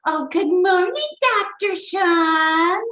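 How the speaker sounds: high, slow, sing-song cheery greeting; sing-song neighbor greeting. Retold or imitated. Neighbor Bob greeting the narrator, high sing-song. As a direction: stretch "Sean" up like a cheerful song. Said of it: "He'd say".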